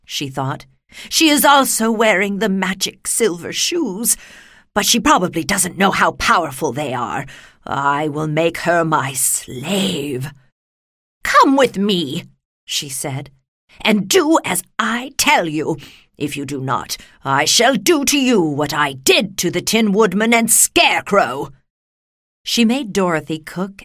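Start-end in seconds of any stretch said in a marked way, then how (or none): 1.1-10.3 scheming witch voice, slowing at the end; wicked witch. A narrator's reading. Witch plots to enslave Dorothy. 11.2-12.2 loud, drawn-out witch command; wicked witch. A narrator's reading. Witch orders Dorothy. 13.8-21.5 witch's threat with forceful stressed words; threatening wicked witch. A narrator's reading.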